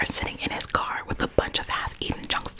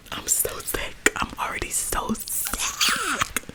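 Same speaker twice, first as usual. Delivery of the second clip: laughing; 6 semitones higher, much slower than usual. Real, not acted. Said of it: "cry whisper laughing fit".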